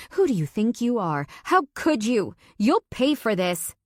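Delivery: wife screaming while punching his back. Acted. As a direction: scream accusations at him.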